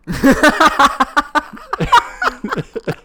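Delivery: high loud cackling laugh. Real, not acted. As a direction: crack up at yourself. Laughs at his own joke.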